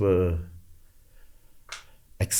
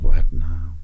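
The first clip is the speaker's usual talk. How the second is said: louder "What now?" as the inner voice; a louder, fed-up "What now?" inside his head; inner exasperation; 3 semitones lower, 7 dB louder than usual. Retold or imitated. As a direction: let "What now?" burst out as his real thought. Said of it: "Deep down, I'm like".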